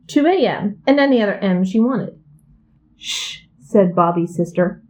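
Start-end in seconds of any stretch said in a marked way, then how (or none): 3.0-3.5 soft hushed shh; hushing. A narrator's reading. Sister hushes the puppy barking at night, softly. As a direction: shush the puppy quietly.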